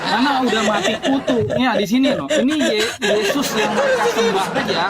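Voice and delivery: laughing while talking; amused, laughing through words. Real, not acted. Mocking the opponent, laughing.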